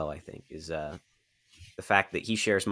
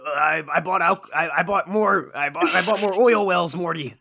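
higher stammering mad scientist voice; Rick Sanchez voice; 8 semitones higher than usual. Retold or imitated. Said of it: "in the Rick and Morty voices".

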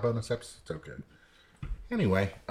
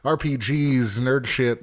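show intro; 6 semitones higher, 7 dB louder than usual. Acted. Host welcoming listeners to the podcast, big high-pitched intro.

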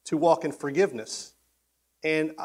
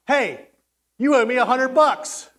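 loud, high shout at a debtor; angry demand; 8 semitones higher, 6 dB louder than usual. Acted. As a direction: demand your money back, hammed-up fury. Acted debt collector confronting Phil.

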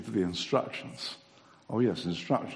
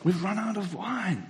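higher anxious little cry of the host; worried wedding host; 8 semitones higher than usual. Retold or imitated. Wedding host telling Jesus the wine is gone, higher and worried. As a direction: blurt out the wine problem. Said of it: "It's like".

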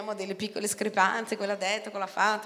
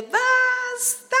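high, sung-out cry; a high sung shout of enough; shouted plea; 4 dB louder than usual. Pretend. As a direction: cry it out to heaven. Imagined cry to heaven.

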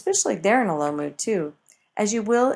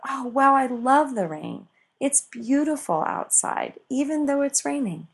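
higher, bright delight at the rain; 7 semitones higher than usual. Pretend. Cheerful high mood at the rainy window. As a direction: gush over the rain like it's a gift.

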